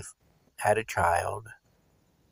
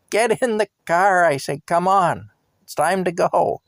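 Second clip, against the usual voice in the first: high, raised call to get in; 7 semitones higher, 3 dB louder than usual. Retold or imitated. Driver calling his friend back to the taxi, raised. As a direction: call him back, pitched up.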